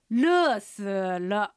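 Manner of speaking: "熱死了" drawn out slowly syllable by syllable; Mandarin pronunciation. Shown as a demonstration. Teacher gives the summer phrase in Chinese, slow Mandarin. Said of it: "中国語で言うと".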